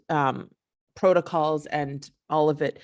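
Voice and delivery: ordinary talk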